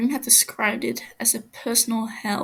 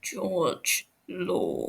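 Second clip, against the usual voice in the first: growled. Acted. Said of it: "he growls".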